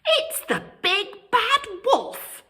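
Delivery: high, frightened piggy cry. A narrator's reading. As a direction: cry out in alarm at the wolf. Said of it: "cried Horace".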